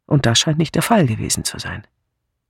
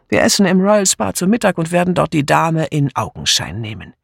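ordinary delivery

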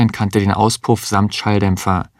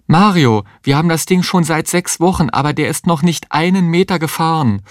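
higher, anxious woman's voice; frightened pleading; 8 semitones higher than usual. Acted. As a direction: plead with him in alarm. Frightened wife pleads in labour. (ängstliches Flehen, höher)

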